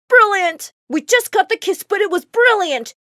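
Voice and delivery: high-pitched, gleeful praise; excited showbiz exclaiming; delighted director. A narrator's reading. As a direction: burst out with thrilled congratulations. Director bursts out applauding.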